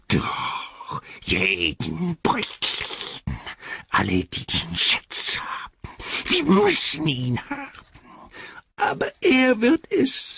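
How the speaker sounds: a wretched creature bickering with itself; Gollum voice. Acted. Gollum arguing with himself.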